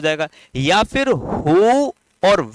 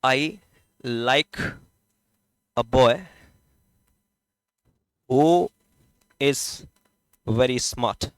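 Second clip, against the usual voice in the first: dictating slowly; 6 semitones lower, 4 dB quieter, much slower than usual. Shown as a demonstration. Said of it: "जैसे पहला question".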